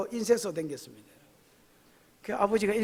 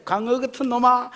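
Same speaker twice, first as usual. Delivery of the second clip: scolding; 6 semitones higher, 9 dB louder than usual. Pretend. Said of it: "주님이 책망하고 있으면".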